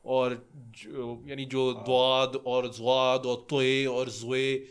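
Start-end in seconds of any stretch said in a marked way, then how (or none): 1.9-4.6 "دواد", "زواد", "توئے", "زوئے" each fully rounded and drawn out; full articulation. Shown as a demonstration. Showing how Quran class says "دواد" and "توئے", full articulation. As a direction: slowly sound out each heavy letter, "دواد" to "زوئے". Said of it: "یہ پورے تلفظ کے ساتھ نکالتے ہیں".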